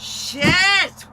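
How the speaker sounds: high, loud panicked swear; realising she is late, loud alarmed curse. Acted. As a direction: yelp it in sudden panic.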